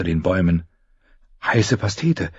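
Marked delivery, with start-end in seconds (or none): 1.4-2.3 hushed, startled inner voice; startled thought. Acted. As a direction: think it with a jolt of fright. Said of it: "dachte sie erschrocken".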